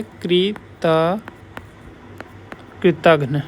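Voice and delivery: "कृतघ्न" broken slowly as kri, ta, then kritaghna; syllable by syllable. Shown as a demonstration. Teacher contrasting the opposite word for students, syllable by syllable. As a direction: sound out "कृतघ्न" slowly, then join it with a clear घ्न. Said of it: "ऐसे होता है".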